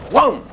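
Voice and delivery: sudden high whoom; short punched whoom with a pitch jump; pressure-wave whoom. Shown as a demonstration. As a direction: imitate a blast hitting the ear. Imitates pressure wave hitting.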